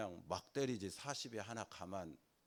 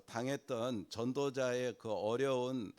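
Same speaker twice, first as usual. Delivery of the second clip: ordinary talk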